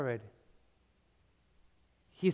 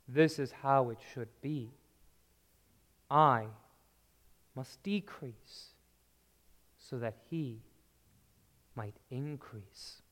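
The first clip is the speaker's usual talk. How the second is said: calm humble; 4 semitones lower, 6 dB quieter than usual. Acted. John's humble calm reply.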